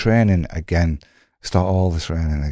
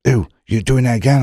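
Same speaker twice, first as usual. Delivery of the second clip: accusing boss; 5 semitones higher than usual. Retold or imitated. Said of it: "He's at me".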